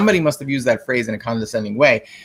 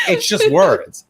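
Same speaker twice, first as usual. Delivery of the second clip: exasperated; 6 semitones higher, 4 dB louder, slower than usual. Retold or imitated. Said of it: "I'm just like".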